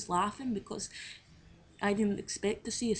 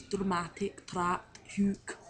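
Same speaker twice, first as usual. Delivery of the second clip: favourite words in an attempted Scottish accent; Scottish word drill, one at a time; 6 dB louder, much slower than usual. Shown as a demonstration. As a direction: recite each word separately with Scottish vowels. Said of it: "I'm gonna say my favourite words".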